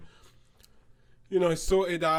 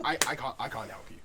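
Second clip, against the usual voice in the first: soft apology; 6 semitones lower, 8 dB quieter, faster than usual. Retold or imitated. Apologizing to customer again.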